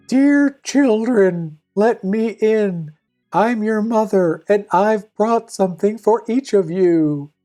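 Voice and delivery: high, slow, sweet mother imitation; wolf posing as mother, drawn out and high; falsely soft wolf. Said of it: "with a soft voice".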